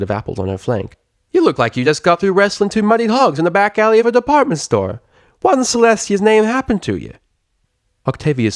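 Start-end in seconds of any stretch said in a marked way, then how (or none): 1.3-7.1 put-on high, loud country accent; astonished country pony; Southern farm mare. A narrator's reading. Applejack asking Octavia what happened, Southern.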